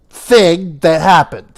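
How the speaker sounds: ordinary talk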